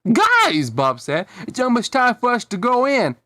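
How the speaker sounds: cartoon kid calling the gang. A narrator's reading. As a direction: holler it up high to get attention. Bob calling the other kids.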